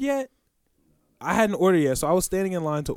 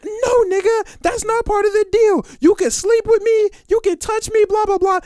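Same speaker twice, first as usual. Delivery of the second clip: high, squealed mock rant; wilding girl; 5 dB louder than usual. Prostitute rants back at the big man, high mock outrage. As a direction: imitate a woman going off, pitched way up.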